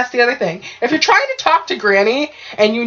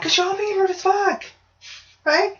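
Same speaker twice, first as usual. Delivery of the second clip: high retold scold; 12 semitones higher than usual. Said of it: "I'm like".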